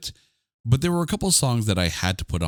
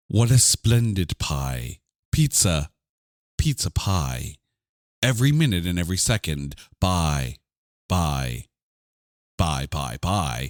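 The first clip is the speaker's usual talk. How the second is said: dramatic reading; 4 semitones lower than usual. Pretend. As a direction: read it like a stage actor, mildly. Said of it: "dramatic reading of the verse here".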